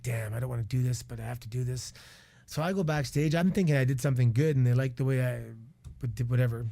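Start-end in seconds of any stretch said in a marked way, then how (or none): 0.0-1.9 low, soft imagined inner voice; reluctant aside. Retold or imitated. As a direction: voice his reluctance under your breath. Imagines referee's reluctant thoughts.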